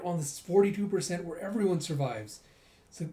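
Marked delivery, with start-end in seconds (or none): none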